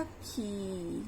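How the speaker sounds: खी held long, low and soft; long ई sound. Shown as a demonstration. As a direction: stretch the ee in खी gently. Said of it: "क्या उच्चारण करेंगे इसका?".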